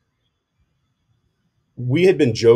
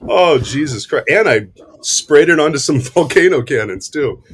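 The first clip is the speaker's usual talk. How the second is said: laughing; 6 semitones higher, 6 dB louder than usual. Real, not acted. Coffee sprayed on models.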